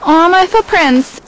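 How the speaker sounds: high, loud and slow coo; a drawn-out delighted aw; gushing aw. Real, not acted. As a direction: gush over the footprints. Cooing over footprints in snow.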